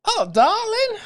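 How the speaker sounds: very high, loud sweet darling; high-pitched cashier. Retold or imitated. Imitating the seven eleven cashier, high-pitched and sweet. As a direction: squeak it like a sweet Texan cashier lady. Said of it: "She, she was high-pitched".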